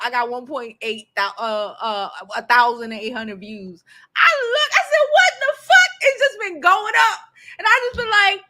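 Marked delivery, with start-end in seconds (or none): 4.2-5.9 loud, much higher, half-laughing "what the fuck"; shocked shout. Real, not acted. Telling co-host about surging views, shocked shout. 6.0-8.4 excited. Real, not acted. Still excited about views.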